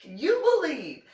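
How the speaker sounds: a rising, shrill parody of gossip; mocking Anna. Anna complaining about the Medal of Honor project, high mock disbelief. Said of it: "I can literally see her being like".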